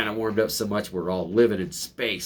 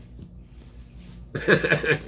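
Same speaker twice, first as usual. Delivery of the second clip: whispery laugh; 7 semitones higher than usual. Real, not acted. Mocking laugh.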